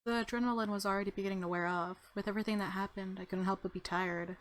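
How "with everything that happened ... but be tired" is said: usual speaking voice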